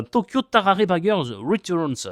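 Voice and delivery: slow, loud, showy English pronunciation; French speaker's exaggerated English accent; hammed-up English accent. Shown as a demonstration. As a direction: announce the title in proud mock English. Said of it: "avec mon superbe accent anglais".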